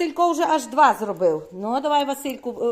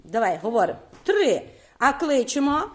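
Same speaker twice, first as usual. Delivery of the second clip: usual speaking voice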